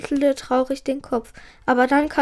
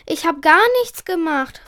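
usual speaking voice

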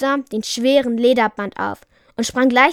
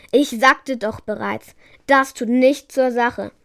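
ordinary delivery, nothing marked

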